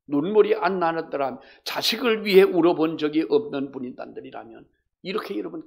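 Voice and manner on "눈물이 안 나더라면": ordinary talk, nothing marked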